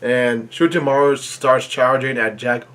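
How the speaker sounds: usual speaking voice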